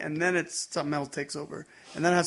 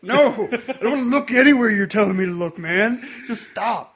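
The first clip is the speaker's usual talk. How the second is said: loud, higher-pitched comic protest; mock exasperated outburst; 6 semitones higher, 8 dB louder than usual. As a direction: blow up at a nagging friend in jest. Comic outburst at co-host's nagging.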